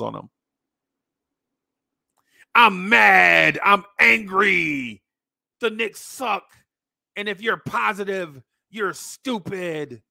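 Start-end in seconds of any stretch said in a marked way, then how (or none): 2.5-10.0 hammed-up rant, opening in a loud bellow then easing; drawn-out, higher-pitched mock fury, fading in volume; mock angry fan. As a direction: imitate a perpetually outraged fan. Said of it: "people like that".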